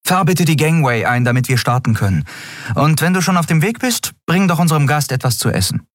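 high, brisk captain voice; captain giving orders. A narrator's reading. Captain sends boy on errands, brisk and high.

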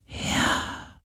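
faint breathy whisper; breathed ghost whisper. Acted. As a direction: barely breathe it out. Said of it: "hauchte der Geist".

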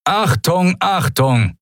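booming tannoy call, high and pushed; loudspeaker announcement. Acted. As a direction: bark it over a PA system. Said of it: "donnerte … über versteckte Lautsprecher".

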